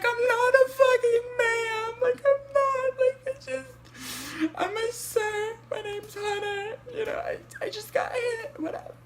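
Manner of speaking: very high, wailing sobs with a cry shout; sobbing hard. Retold or imitated. Upset caller sobbing at the dispatcher who called him ma'am. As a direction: break down crying, voice cracking high. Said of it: "I'm already crying. So, like, I start crying hard".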